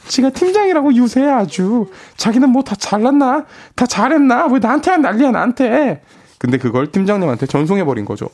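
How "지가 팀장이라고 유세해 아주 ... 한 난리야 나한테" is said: angry rant. Acted. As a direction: vent about the boss, fuming. Angry text read as rant.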